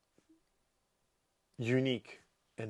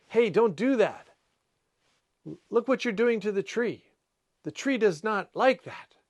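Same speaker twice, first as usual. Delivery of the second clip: higher, louder, mock telling-off; playful scolding; 9 semitones higher, 5 dB louder than usual. To a stranger shaking a tree branch, a playful loud telling-off. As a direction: scold them like a cheeky busybody. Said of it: "I would say something like that".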